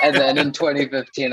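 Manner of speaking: words bubbling with laughter; laughing. Real, not acted. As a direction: keep laughing as you start the line. Laughing while speaking.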